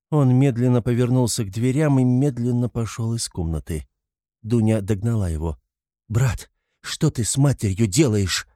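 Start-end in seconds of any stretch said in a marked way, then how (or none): none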